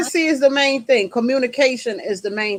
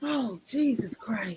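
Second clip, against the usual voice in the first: exasperated sigh; 3 semitones lower, 12 dB quieter, slower than usual. Real, not acted. Exasperated reaction to sex comment.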